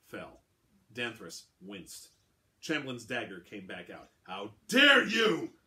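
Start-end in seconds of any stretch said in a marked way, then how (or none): 4.2-5.6 very slow, high, loud outrage; outraged. Acted. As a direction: roar the insult back. Insulted quartermaster draws dagger.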